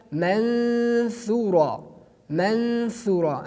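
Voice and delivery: slow manthura, n hidden in a nasal hum; drawn-out ikhfa. Shown as a demonstration. Teacher reciting manthura slowly for students. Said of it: "teacher demonstrating ikhfa slowly".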